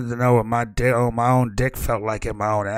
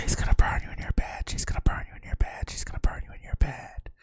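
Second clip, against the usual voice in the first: whisper; 7 dB quieter, faster than usual. Acted. GM whispers echo of threat.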